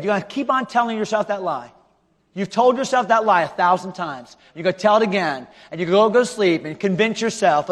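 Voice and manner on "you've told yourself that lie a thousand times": ordinary delivery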